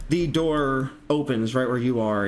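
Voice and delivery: ordinary talk, nothing marked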